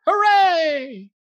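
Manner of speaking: high, loud hooray; cheering. Acted. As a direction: cheer it out like a crowd. Cheering after hip hip.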